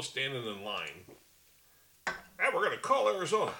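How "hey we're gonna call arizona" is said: mock announcer. Imitating the network calling Arizona.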